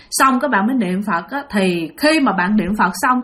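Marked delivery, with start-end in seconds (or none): none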